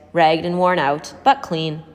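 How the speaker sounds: ordinary delivery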